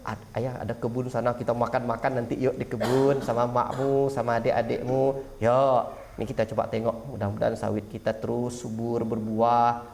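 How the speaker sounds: gentle father coaxing. Retold or imitated. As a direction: coax your son kindly to come out to the garden. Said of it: "terus ajarkan dia dengan kehidupan".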